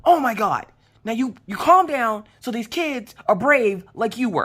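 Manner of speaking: high-pitched, urgent self-scolding; acted-out strained order to calm down; yelling at oneself. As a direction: plead with yourself to get a grip. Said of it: "yelling at myself to calm the hell down".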